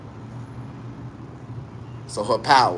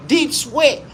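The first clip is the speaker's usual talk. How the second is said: ordinary delivery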